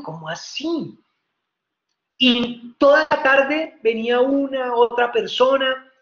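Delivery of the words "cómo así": low, hushed, puzzled disbelief; surprised. Acted. Rabbi watching the innkeeper receive coins, quietly surprised. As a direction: ask softly, taken aback. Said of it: "este rabino sorprendido".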